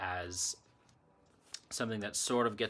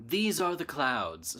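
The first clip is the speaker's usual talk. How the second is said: high, slow poem title in a faint Irish lilt; slight Irish accent; 6 semitones higher, 4 dB louder, slower than usual. Real, not acted. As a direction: read it out slowly, lightly tinged with Irish. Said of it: "may end up accidentally doing it in an Irish accent".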